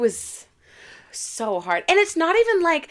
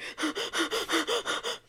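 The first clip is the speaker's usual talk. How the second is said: string of quick, rising gasps; laboured panting; 8 semitones higher, 10 dB quieter than usual. Acted. Actor miming her slow climb to the role, panting. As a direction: pant as if climbing a hill.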